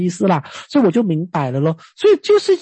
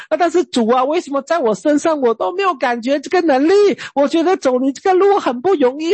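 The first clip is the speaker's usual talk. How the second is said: higher, mock-whiny grumbling at God; a child pouting at his father, rising in pitch; complaining prayer; 7 semitones higher than usual. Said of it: "老实说，我真的跟神讲啊".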